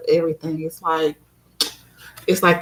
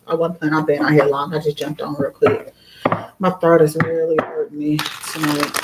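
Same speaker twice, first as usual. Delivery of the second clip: normal talking voice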